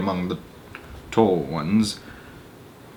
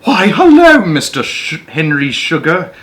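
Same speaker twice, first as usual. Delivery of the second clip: much higher, louder gushing welcome; effusive greeting; 8 semitones higher, 8 dB louder than usual. Acted. Desk man spots Henry Sugar at the door, gushing hello. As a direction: greet him like a long-lost favourite client. Doorman greets returning regular.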